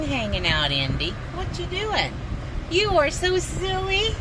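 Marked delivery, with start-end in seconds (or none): 1.3-4.1 much higher, playful pet voice. Real, not acted. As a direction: tease the dog in a high squeaky voice. Teasing the excited dog.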